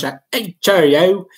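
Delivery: high, hammy British cheerio; mock British cheerio. Streamer imitating Brits from soccer, bad British accent. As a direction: play a cartoon Englishman saying cheerio. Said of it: "I don't have good accent".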